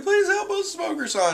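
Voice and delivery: squeaky falsetto begging; mock tearful plea. Tashigi on the ground begging Smoker, mock tearful plea. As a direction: plead in a put-on, very high helpless voice. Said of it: "lying on the ground crying like usual".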